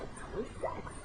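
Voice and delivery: breathy whisper to herself; whispered aside. Retold or imitated. Talking herself down, whispered. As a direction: whisper it under your breath.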